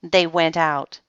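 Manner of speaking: ordinary delivery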